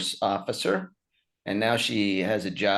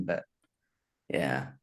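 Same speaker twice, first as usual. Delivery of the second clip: normal talking voice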